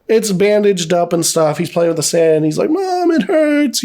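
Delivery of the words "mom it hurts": put-on high, drawn-out child's whine; mock crying out. Son with bloody foot calling his mother, mock cry. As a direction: imitate a hurt kid calling for mom. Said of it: "he's crying out for his mom".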